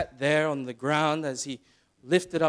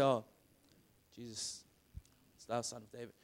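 much softer and lower, "Jesus, it's thou Son of David" barely said; timid murmur; 4 semitones lower, 10 dB quieter than usual. Shown as a demonstration. Preacher showing how Bartimaeus did not call, meek and quiet. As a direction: mumble it meekly, no urgency. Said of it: "No, he was shouting".

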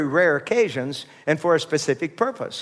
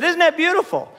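pushed out louder, prompting the crowd, with a laugh; louder repeat; 13 semitones higher than usual. Shown as a demonstration. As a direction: call it out again so they answer. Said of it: "I said".

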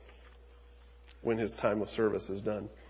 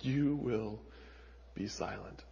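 hushed echo; 2 semitones lower, 11 dB quieter than usual. Acted. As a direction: let "silent" die away softly. Repeats the verdict, hushed.

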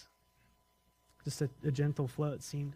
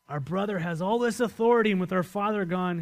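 scared brothers; 4 semitones higher, 7 dB louder than usual. Acted. Scared brothers voiced in story.

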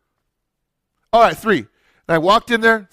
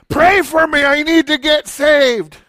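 higher, slow pleading voice; drunk man pleading; 6 semitones higher, 3 dB louder, slower than usual. Retold or imitated. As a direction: beg like a drunk seeking help.

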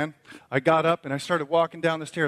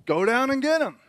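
calling back; 9 semitones higher, 6 dB louder than usual. Retold or imitated. Dad calling to son.

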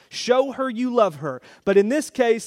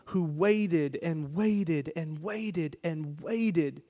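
drawn out; 2 semitones lower, 6 dB quieter, much slower than usual. Acted. Drawn-out waiting in sermon.

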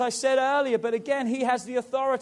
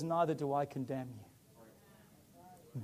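tender; 6 semitones lower, 9 dB quieter, much slower than usual. Acted. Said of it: "Jesus says".

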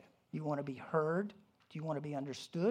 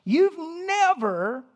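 slow, much higher and louder accusation; mock accusing; 11 semitones higher, 10 dB louder, much slower than usual. Spouse accusing partner in an argument, hammed-up and slow. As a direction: drag out the blame like a spouse mid-fight. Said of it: "Here's the typical saying".

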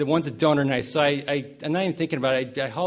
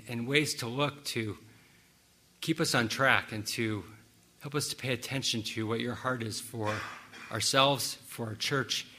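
prayer; 2 semitones lower, 4 dB quieter than usual. Acted. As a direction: pray quietly over the church. Leads congregation in prayer.

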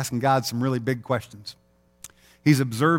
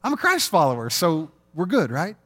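slow, higher, louder mock-cheery announcement; 7 semitones higher, 5 dB louder than usual. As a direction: play it innocently pleased with yourself.

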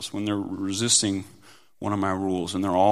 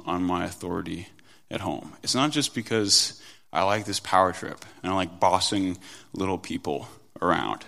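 ordinary talk

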